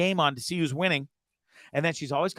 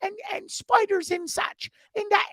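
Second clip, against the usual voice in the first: fussy English mum complaining; squeaky British mum grumbling about monkeys; mom's accent. Retold or imitated. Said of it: "That's what she calls Nicaragua".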